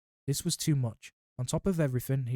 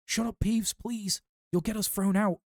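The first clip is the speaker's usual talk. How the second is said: higher, urgent plea to hush a poltergeist; pleading; 8 semitones higher than usual. A narrator's reading. Harry begging Peeves to hush.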